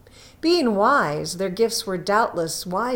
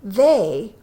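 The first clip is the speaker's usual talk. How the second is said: slow, weighty closing words; slow, solemn ending; solemn moral; 4 dB louder, slower than usual. A narrator's reading. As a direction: deliver the moral slowly and gravely.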